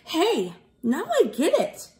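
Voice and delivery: happy elf catching on; grinning realization. Acted. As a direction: let the penny drop with a grin. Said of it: "Schmelf said with a grin".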